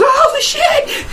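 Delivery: high laughing panicked cry; panicked scream. Retold or imitated. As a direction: shriek like you just woke up abducted. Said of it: "wakes up and goes".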